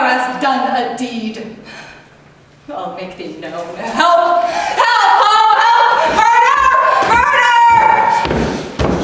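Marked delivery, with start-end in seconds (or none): 3.8-9.0 high, loud panicked shouting; screaming for help. Acted. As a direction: raise the alarm through the house.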